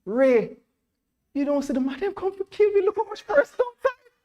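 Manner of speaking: Jamaican patois, fast and climbing very high in alarm; a scared friend in Jamaican patois pointing at the crowd outside; panicky Jamaican servant. Acted. Jeremy to Ray about the men outside, high panicky Jamaican patois. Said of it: "Jeremy gets up and looks outside and say".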